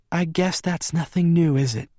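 high frail woman's voice; Bella. A narrator's reading. Bella answers her visitor after a faint sigh, high frail voice. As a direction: voice a weak female character, pitched high.

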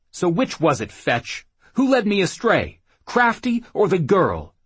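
loud, high, accusing questions; angry demand. Acted. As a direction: demand answers in anger. Man confronting woman in a fight.

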